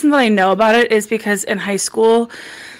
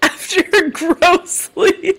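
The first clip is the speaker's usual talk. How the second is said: laughing; 11 semitones higher, slower than usual. Real, not acted. Cracking up at the joke.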